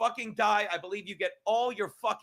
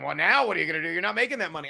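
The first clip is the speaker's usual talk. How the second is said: mocking critic voice; 4 semitones lower, 5 dB louder, faster than usual. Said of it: "you're like".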